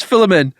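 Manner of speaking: "Philemon" as FILL-uh-mun, pitched high; Michael's pronunciation. Retold or imitated. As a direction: quote "Philemon" with a short first i. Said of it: "and he says Philemon".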